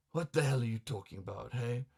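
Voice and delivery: plain reading voice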